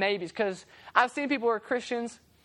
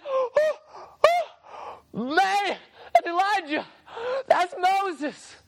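high-pitched, gasping awe, hammed up; excited; 7 semitones higher, slower than usual. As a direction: act out a starstruck disciple, high and gushing. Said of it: "And Peter, in his excitement".

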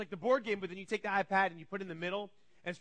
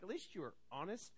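much softer, understated reply; resigned aside; 3 semitones lower, 13 dB quieter than usual. Retold or imitated. Parent to Colin admitting disobedience, quiet resigned reply. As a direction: drop to a quiet parental concession. Deflated parent answers child's confession.

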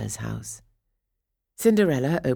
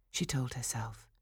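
whispered; 3 semitones lower, 10 dB quieter than usual. A narrator's reading. Narration dropped to a whisper.

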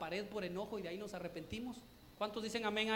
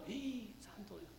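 awed hush; 3 semitones lower, 8 dB quieter, much slower than usual. Retold or imitated. Child's shocked reaction.

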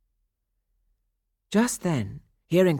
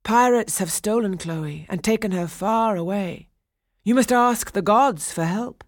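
stone nymphs; 5 semitones higher, 5 dB louder than usual. A narrator's reading. Nymphs answering Daphnis.

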